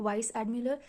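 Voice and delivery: ordinary talk, nothing marked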